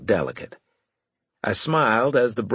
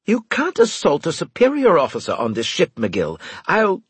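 furious outburst; 9 semitones higher, 4 dB louder than usual. A narrator's reading. Winslade furious, continues threat.

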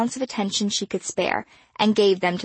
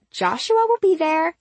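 lightly voiced teen girl; 9 semitones higher, 4 dB louder than usual. A narrator's reading. Star teasing.

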